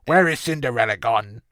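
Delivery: lightly imitated annoying-human character; a rough go at an irritating cartoon character. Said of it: "sounds like an annoying human".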